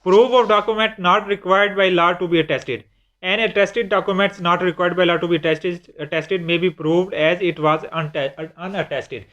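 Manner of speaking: slow, deliberate reading of the English statute; reading law text. Shown as a demonstration. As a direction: read each word out slowly like a clause. Reads statute text aloud. (धीमी आवाज़ में क़ानून की इबारत पढ़ना)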